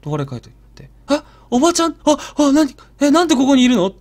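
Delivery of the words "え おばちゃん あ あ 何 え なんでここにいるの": higher, louder startled questions; boy snapping awake, startled and confused. Retold or imitated. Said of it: "もうびっくりしてる". (びっくりした甲高い声)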